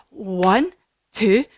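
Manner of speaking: ordinary talk, nothing marked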